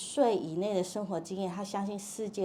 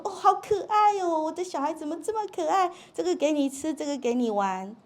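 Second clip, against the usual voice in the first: put-on much higher, louder, cooing baby talk; doting mother; 8 semitones higher, 4 dB louder than usual. Mother coos over her good baby, doting. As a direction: gush over the child like a fond mother. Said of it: "妈妈说".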